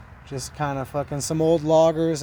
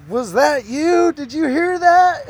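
alarmed calling out; 10 semitones higher, 7 dB louder, slower than usual. Retold or imitated. Scared friends calling out.

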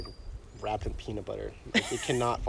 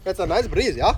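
hammed-up Canadian accent, higher and louder on nice; mock Canadian accent; 8 semitones higher, 4 dB louder than usual. Friend mocks her Canadian breeze line in hammed-up Canadian. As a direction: play up a Canadian twang with a rising yeah. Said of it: "That is Canadian, eh?".

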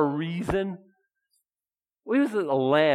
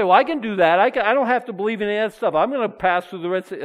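a louder, quick cocky doubter brushing off faith; mock doubter boasting; 6 semitones higher, 8 dB louder, faster than usual. Preacher voicing a scoffing listener, mock cocky and loud. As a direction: voice the listener who thinks he needs no belief, tossed off fast. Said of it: "You say".

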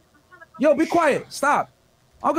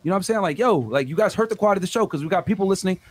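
ordinary talk

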